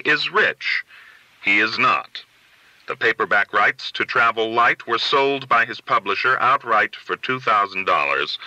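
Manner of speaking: plain reading voice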